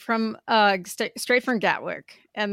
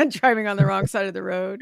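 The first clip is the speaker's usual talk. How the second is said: laughing; 5 dB louder than usual. Real, not acted. Laughing about bad driving.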